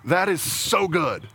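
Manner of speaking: slow, relishing delight; slow and emphatic, savoring it; savoring approval. Acted. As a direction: dwell on each word, deeply pleased. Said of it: "God says this over and over".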